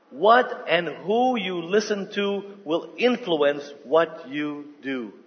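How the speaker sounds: slow, deliberate repeat; slow congregation response. Shown as a demonstration. Congregation repeating the principle after the preacher, slow and deliberate. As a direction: say it slowly with the crowd. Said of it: "Can you all say that?".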